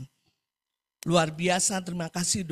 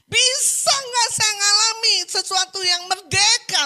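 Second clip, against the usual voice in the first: loud, high-pitched pleading wail; acted anguished outcry; imitated crying plea; 13 semitones higher, 10 dB louder than usual. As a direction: imitate a desperate mother begging through tears. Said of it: "sambil nangis".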